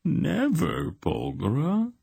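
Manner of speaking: high mocking insinuation; insinuating. A narrator's reading. Dark god mocking Polgara's refusal, insinuating rasp. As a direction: toy with her refusal. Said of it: "dreadful insinuation in the god's rasping voice".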